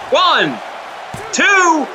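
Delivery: rising shout on the pin count; excited count. Acted. Three count called.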